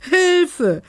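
high, loud cry of alarm; scream for help. A narrator's reading. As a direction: shriek it out for help. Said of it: "schrie Belinda".

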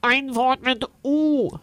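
langsam und bestimmt, höher; energisch. A narrator's reading. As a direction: fordere ihn energisch auf. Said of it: "sagt Unzel Funzel energisch". (energische Wesenstimme)

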